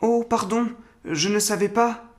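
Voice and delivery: put-on high, lightly sheepish boy voice; high-pitched, mildly contrite little boy; sheepish young boy. A narrator's reading. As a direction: apologise as a small abashed child. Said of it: "fit-il tout penaud".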